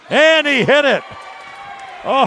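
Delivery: sudden high, loud shout of surprise; excited call. Real, not acted. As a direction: call the shot going in with a burst. Buzzer shot goes in.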